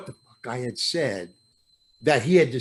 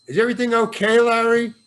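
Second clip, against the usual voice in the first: raised, higher call across the set; director yelling; 6 semitones higher, 4 dB louder, faster than usual. Retold or imitated. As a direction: call out loudly from far off. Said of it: "I hear the director yell".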